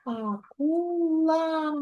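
Акула split into slow syllables а-ку-ла; teacher sounding out Акула slowly in syllables. Shown as a demonstration. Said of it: "промовляй слово".

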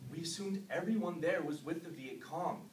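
low, soft, haunted recounting; hushed confession. Acted. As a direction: murmur it low and numb. Confessing killings of villagers.

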